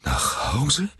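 high, rising, disbelieving question; incredulous. A narrator's reading. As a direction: echo it in disbelief. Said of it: "wiederholte Mister Button ungläubig". (ungläubig fragend)

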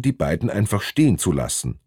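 plain reading voice

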